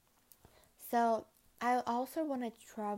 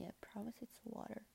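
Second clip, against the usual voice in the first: quiet ASMR voice; 14 dB quieter than usual. Pretend. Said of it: "Guys, look, A S M R".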